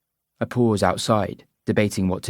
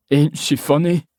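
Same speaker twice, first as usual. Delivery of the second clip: Scottish pub man; 6 semitones higher, 5 dB louder than usual. Acted. Man to his mate.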